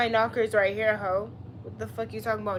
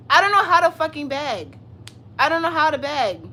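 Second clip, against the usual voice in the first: louder and higher, throwing up her hands; exasperated outburst; 6 semitones higher, 9 dB louder than usual. Real, not acted. Streamer told to beg on her knees, loud exasperated protest. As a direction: snap back at the chat, loud and higher.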